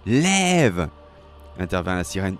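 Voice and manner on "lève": high, loud cry of the name; shouted intervention, high then falling; scolding shout. Acted. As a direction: call out sharply to stop him. Mermaid intervenes, calling the sailor.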